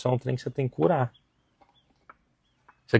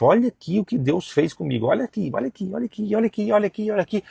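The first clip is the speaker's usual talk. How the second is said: higher, faster "olha aqui" repeated; mock an eager believer showing off; excited showing-off; 11 semitones higher than usual. Preacher imitating a believer showing off blessings, higher and fast.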